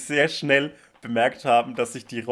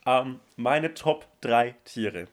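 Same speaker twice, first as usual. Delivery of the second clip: normal talking voice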